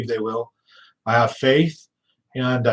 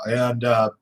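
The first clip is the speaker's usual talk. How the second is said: ordinary delivery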